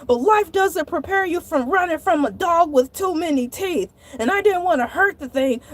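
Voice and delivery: ordinary talk, nothing marked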